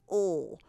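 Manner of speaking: "ol" said as a short dark ending sound, loud; ending sound demo. Shown as a demonstration. Teacher showing parents the ending "ol" sound. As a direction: punch out "ol" on its own. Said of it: "we just hear that "ol" at the end".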